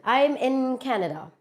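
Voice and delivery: ordinary talk, nothing marked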